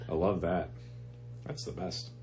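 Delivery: low, quiet sarcastic approval; deadpan sarcasm. Real, not acted. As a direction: say it drily, dropping away softly as if you loathe it. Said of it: "You can hear it in your voice".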